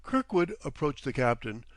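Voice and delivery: ordinary delivery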